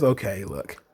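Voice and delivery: imitating Rhea. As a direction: drop it low and quiet. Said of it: "then Rhea says".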